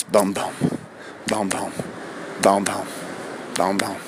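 low, soft, evenly spaced thumps; steady low pulse sound; imitated heartbeat. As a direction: count out slow heartbeats in a deep, softened voice. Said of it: "I count my heartbeats".

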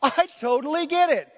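hammed-up fake comprehension; mock understanding. Preacher mocking Nicodemus, an overdone I-get-it. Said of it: "Not, not even close".

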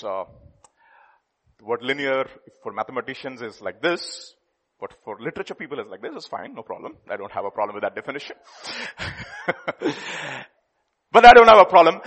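ordinary talk, nothing marked

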